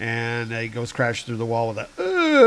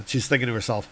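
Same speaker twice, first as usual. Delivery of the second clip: usual speaking voice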